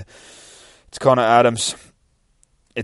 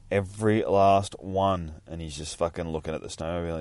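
plain reading voice

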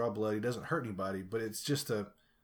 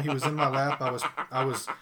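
ordinary talk

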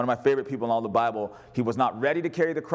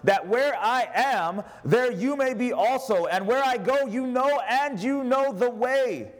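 scripture reading; 6 semitones higher than usual. Acted.

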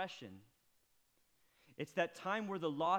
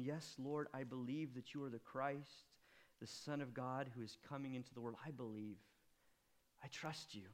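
soft, low, fading avowal; quiet confession; 5 semitones lower, 9 dB quieter than usual. Acted. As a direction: let it fall away to nothing.

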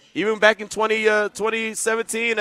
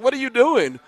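car scolding him; 5 semitones higher than usual. Retold or imitated. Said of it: "My car was angry at me".

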